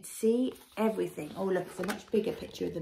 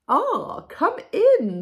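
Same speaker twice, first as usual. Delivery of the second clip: high, loud, grand welcome; very posh voice; 10 semitones higher, 12 dB louder, much slower than usual. A narrator's reading. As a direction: invite them in like royalty. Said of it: "with that very posh voice".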